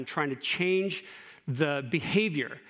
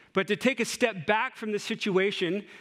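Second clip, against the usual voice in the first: usual speaking voice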